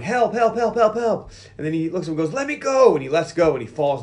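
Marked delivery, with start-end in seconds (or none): 0.0-1.3 high-pitched mock cry for help; retold yelling. Boy yelling for help when grabbed, hammed-up mock yell. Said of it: "And he starts yelling". 2.3-2.9 high, louder mock protest; mock yell. Boy looks at the Terminator holding him, yelling in mock protest.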